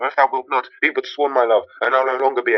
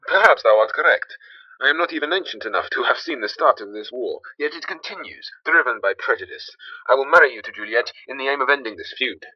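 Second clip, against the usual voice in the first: ordinary talk, nothing marked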